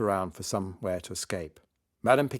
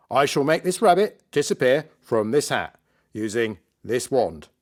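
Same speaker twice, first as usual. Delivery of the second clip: showy stage magician; 3 semitones higher, 5 dB louder than usual. Acted. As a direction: present the trick to a crowd with a flourish.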